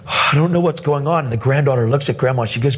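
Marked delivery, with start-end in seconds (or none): 0.1-1.2 higher-pitched mock tearful bewilderment; half-acted weepy confusion; imitated crying. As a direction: voice the overwhelmed grandmother, lightly tearful. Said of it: "she's crying".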